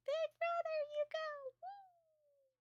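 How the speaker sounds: very high squeaky little-sister cheer; piercingly high mock fangirl voice, fading into a squeal; Shizuka. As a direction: cheer on a big brother in a tiny falsetto. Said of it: "And Shizuka is, of course, going like".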